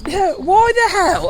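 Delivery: incredulous outburst. Real, not acted. Reacting to top secret meetings.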